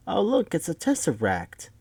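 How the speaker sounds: a hint of a chirpy old lady. Old Missus Paley spotting the square on the wall, lightly old lady. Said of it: "from the old lady side".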